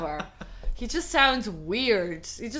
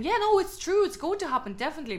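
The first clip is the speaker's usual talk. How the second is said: confident gossip; 8 semitones higher, faster than usual. Retold or imitated. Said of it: "people saying".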